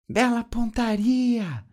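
high, drawn-out cry; high-pitched character yell; Chiquinha yelling. A narrator's reading. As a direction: call it out high and slow. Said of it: "Ele ouviu Chiquinha gritar".

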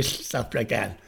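softly slurred "stuff like that"; speech defect imitation. Shown as a demonstration. Showing the defect that was gone, slurred imitation. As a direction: imitate the slushy consonants in "stuff like that". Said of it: "I didn't hear that s".

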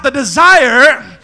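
loud, high, stretched-out preacher shout; drawn-out shout. Shown as a demonstration. Preacher telling congregation to shout, drawn-out shout. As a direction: shout it slowly with full lungs. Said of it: "somebody shout".

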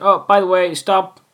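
hammed-up order cutting into the moment; mock interruption. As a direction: break the mood with a bossy aside.

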